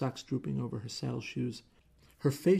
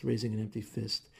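plain reading voice

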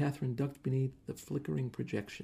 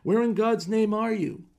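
furious shouting; 9 semitones higher, 8 dB louder than usual. Acted. Husband shouting threats from stage.

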